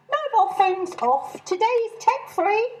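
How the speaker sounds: high, brisk call of orders; bossy announcement. Acted. Chairwoman laying down rules to the bus, high. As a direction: play the self-important organiser. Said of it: "I called out".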